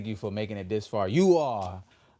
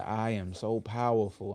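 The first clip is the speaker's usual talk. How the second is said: slow affirmation; slower than usual. Shown as a demonstration. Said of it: "Let's affirm that together".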